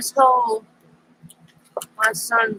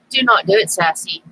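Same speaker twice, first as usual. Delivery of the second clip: scolding the cat; 8 semitones higher than usual. Real, not acted.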